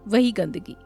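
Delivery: ordinary delivery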